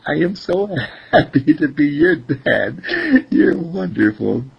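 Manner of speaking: happy, chuckling storybook dad; acted joy with a laugh in it; laughing delight. Delighted daddy answering his daughter.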